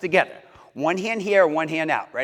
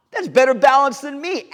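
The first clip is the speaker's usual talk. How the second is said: mock surprise; 8 semitones higher, 5 dB louder than usual. Praising a kid's balance.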